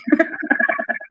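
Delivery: a squealing burst of laughter, very high and soft. Real, not acted. Streamer cackling at her own jab.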